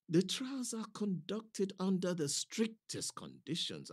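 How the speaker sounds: plain reading voice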